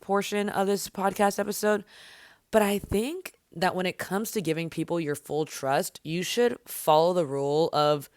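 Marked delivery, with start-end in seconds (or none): none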